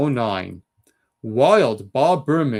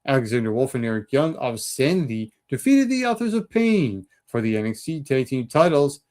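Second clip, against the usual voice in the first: normal talking voice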